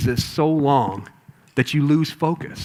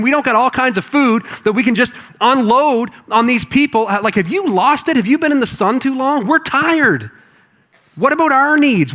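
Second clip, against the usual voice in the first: higher, whiny complaining; annoyed disciples; 5 semitones higher than usual. Acted. Weary disciples complaining to Jesus, higher and whiny. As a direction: grumble like exhausted, fed-up men. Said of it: "out of their minds annoyed".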